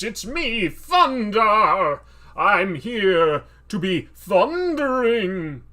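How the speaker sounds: huge, slow, booming shout; loud, drawn-out heroic roar; Thundar the Barbarian. Acted. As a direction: bellow like a cartoon barbarian hero. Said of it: "I don't know what his voice sounds like".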